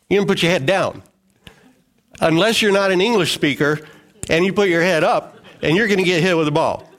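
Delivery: usual speaking voice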